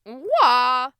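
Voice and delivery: very loud, high syllable; exuberant king. Acted. King demonstrates next sound for boy, booming. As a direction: blast out the sound.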